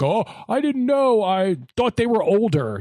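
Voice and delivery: high-pitched imitation of a flustered man; mock defensive excuse. Acted excuse of man caught out.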